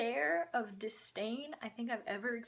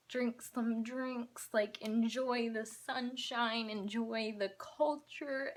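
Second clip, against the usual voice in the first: slow; much slower than usual. Retold or imitated. Sarcastic list to the mom.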